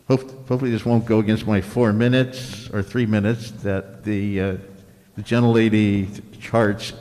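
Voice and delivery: ordinary talk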